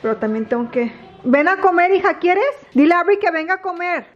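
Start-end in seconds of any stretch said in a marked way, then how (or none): 1.2-4.1 high-pitched mealtime call. Real, not acted. Mother calling daughter to come and eat, calling out. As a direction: call across the house in a higher voice. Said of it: "Yo grite y grite y grite".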